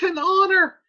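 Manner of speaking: high, loud mock exclamation; acted astonishment. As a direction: pounce on it as the amazed nurse. Nurse reacts to Juliet's answer.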